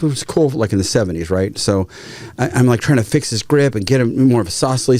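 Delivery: ordinary talk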